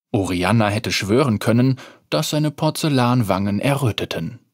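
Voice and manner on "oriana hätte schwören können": usual speaking voice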